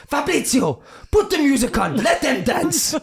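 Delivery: high, loud mocking command to the assistant; sarcastic order. Manager ordering his assistant Fabrizio, sneering loud command. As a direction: order the music on with savage sarcasm. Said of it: "he goes".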